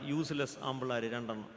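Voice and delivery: ordinary talk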